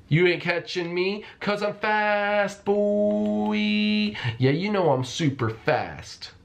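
high, slow, teasing brag while running away; sing-song it high and drawn out like a show-off; taunting fast boast. Pretend. Taunting the hunter while fleeing, high slow teasing brag.

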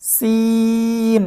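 সিন with a long held ee; three-alif stretch. Shown as a demonstration. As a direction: draw out the vowel of সিন evenly for three alifs. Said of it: "তিন আলিফ টান". (সিন তিন আলিফ টেনে)

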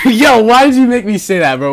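high, loud mock outrage; embarrassed outburst. Real, not acted. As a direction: yell in protest at being made to say it. Embarrassed outburst after doing voice.

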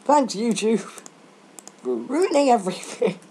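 mock-gratitude with bite; sarcastic. Sarcastic complaint at YouTube.

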